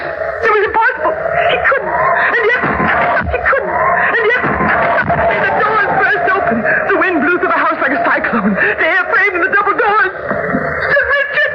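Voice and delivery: high, strained and climbing to a cry; disbelieving horror at the doors; mounting terror. Acted. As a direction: terror growing with each line. Husband appears at terrace doors.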